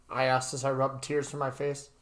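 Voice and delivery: plain reading voice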